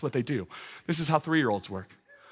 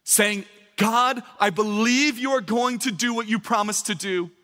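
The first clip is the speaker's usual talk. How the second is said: higher, ringing preacher voice; fervent preaching; 6 semitones higher than usual. Acted. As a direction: drive it home to the church. Preaching at full force.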